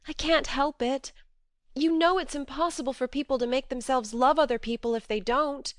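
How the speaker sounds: pleading, remorseful outburst; remorseful cry. A narrator's reading. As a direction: beg him to forgive. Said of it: "cried Joe inelegantly but remorsefully".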